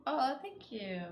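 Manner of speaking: ordinary delivery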